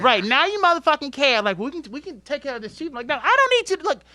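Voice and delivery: loud, high, heated telling-off; retold tirade. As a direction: lay into the managers, preaching loud.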